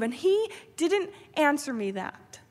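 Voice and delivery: ordinary talk, nothing marked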